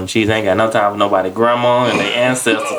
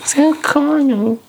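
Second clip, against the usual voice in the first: mock whine; much slower than usual. Mocking reply.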